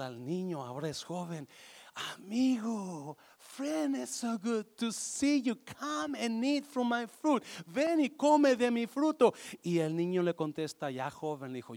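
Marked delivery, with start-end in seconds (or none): 2.2-7.4 a hint of a happy tree, higher and slow; lightly voiced cheerful tree. El árbol saluda a su amigo. 7.7-9.3 lightly voiced cheerful tree. El árbol invita al niño.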